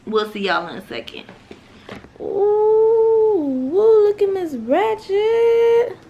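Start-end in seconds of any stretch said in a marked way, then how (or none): 2.2-6.0 high, slow delighted squeal; excited squeal. Real, not acted. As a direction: gush over the outfit. Mom sees daughter's outfit.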